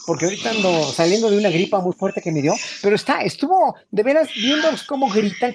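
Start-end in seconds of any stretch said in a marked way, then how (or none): none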